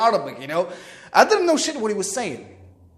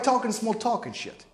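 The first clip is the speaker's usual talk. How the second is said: ordinary delivery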